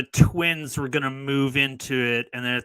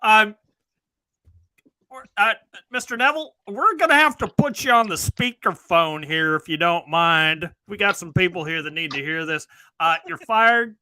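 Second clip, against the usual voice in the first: put-on Southern US accent; 8 semitones higher, 6 dB louder than usual. Podcaster voices a Miami owner in a Southern US accent. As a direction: play a Southern US club boss firing a manager. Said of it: "speak with a Southern United States accent".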